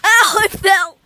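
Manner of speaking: laughing cry, then loud ow; crying out after a fall. Real, not acted. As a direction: yelp as you hit the trampoline. Falls on wet trampoline.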